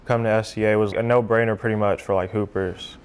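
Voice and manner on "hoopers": normal talking voice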